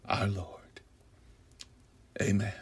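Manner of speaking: whispered close to the mic; hushed. Acted. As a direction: let it fall to a whisper.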